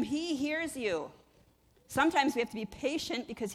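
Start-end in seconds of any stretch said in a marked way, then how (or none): 0.0-1.1 "he hears you" slow, "he" leaned on; stress on he. Shown as a demonstration. Pastor assuring the church that God hears, "he" stressed slowly. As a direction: drag "he" out and land it firmly. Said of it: "when you cry out to him".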